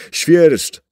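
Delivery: high, loud cry of a name; sudden frightened shout. A narrator's reading. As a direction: yell the name in alarm. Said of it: "krzyknął Ian".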